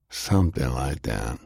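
low, unhurried answer; slow reply. A narrator's reading. Jake answers Delilah about joining the law, replying slowly. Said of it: "he replied slowly".

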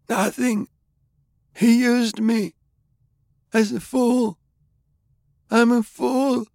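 very high, halting dying voice; anguished confession. A narrator's reading. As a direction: wail out the confession in broken bursts. Dying man confesses in broken bursts.

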